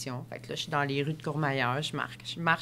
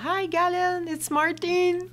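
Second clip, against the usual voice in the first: high, bright hello in English; boyfriend's high greeting; 12 semitones higher, 4 dB louder, slower than usual. Retold or imitated. Boyfriend greets the athlete, high and cheerful. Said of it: "il dit".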